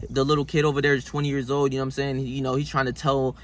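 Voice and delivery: normal talking voice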